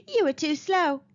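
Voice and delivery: teasing giggle; giggling. Acted. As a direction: giggle through it. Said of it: "giggled Arabella".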